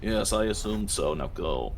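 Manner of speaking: dismissive, clipped-off snob; a hint of a bored snobbish devil. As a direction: brush the visitor off with disdain. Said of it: "I'll do, like, a snob voice".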